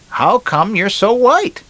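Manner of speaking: very high, slow, drawn-out lady voice; lady in a joke. Acted. Lady on a Miami beach to a stranger, high mock lady voice.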